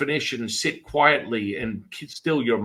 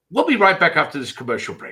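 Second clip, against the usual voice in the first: hurried, louder TV host cutting to a break; higher, rushed mock broadcast sign-off; Oprah; 5 semitones higher, 5 dB louder, faster than usual. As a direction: bail out fast to commercials. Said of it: "And she went".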